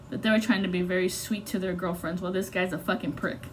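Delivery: ordinary delivery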